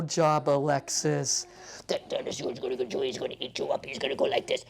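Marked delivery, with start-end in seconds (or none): 1.9-4.6 mock-spooky whisper; playful whisper. Therapist teasing child about dinosaur, playful whisper. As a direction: whisper to build suspense.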